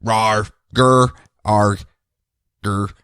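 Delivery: playful rawr growls; mock monster growl. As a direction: grumble like a cartoon beast. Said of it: "Parallax goes".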